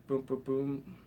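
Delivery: ordinary delivery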